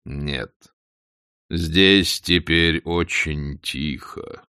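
low, slow voice of Death; Смерть. A narrator's reading. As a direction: answer slowly and deeply, unmoved. Смерть отвечает демону. (низкий, медленный голос Смерти)

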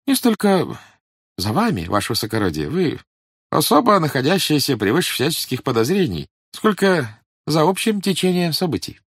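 high, oily police informer; fawning, sly old agent; sly obsequious agent. A narrator's reading. Voices Mylnikov.

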